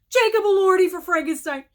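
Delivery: high, loud, fast burst of joy; ecstatic shout. Real, not acted. Announcing a favourite actor's nomination, ecstatic shout. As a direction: yell the name like your favourite just won.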